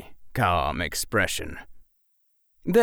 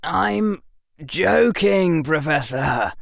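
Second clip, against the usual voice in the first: mildly exasperated; a touch of annoyance; annoyed; 8 semitones higher, 3 dB louder, slower than usual. Acted. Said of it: "with some annoyance".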